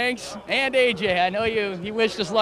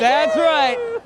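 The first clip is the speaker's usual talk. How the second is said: loud, high celebratory shout; okrzyk triumfu; 10 semitones higher, 7 dB louder than usual. Real, not acted. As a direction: exult at the top of your voice. Winning driver celebrates victory.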